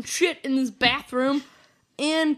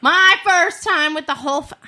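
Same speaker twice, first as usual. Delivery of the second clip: loud and higher restart; louder into the mic; 3 semitones higher, 9 dB louder than usual. Real, not acted. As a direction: speak up into the mic.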